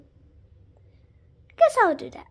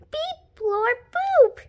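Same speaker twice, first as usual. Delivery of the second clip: beeping robot sounds; 6 semitones higher than usual. Pretend. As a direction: say it like a toy robot. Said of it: "talk like a robot".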